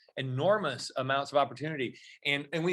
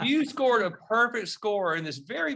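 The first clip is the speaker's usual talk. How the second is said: higher, slow, drawn-out disbelief; mock incredulity; 7 semitones higher, 4 dB louder, slower than usual. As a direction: stretch it out in amused amazement. Retold disbelief at her answer.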